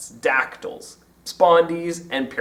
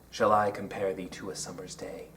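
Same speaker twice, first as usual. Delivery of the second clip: soft, low recitation of "Shall I compare thee to a summer's day" stressing every second syllable; rising rhythm; 7 semitones lower, 10 dB quieter than usual. Shown as a demonstration. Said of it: "of course, a rising rhythm".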